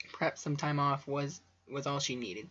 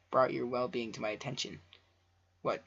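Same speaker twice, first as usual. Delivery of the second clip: ordinary delivery, nothing marked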